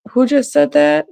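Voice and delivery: high-pitched imitation of a puzzled man; surprised question. Man asks who spoke up, surprised. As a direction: retell his question up high, wondering.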